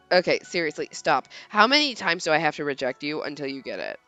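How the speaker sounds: put-on low, fed-up teenage boy; low exasperated boy. Leon voiced in read story.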